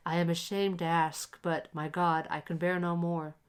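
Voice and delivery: ordinary delivery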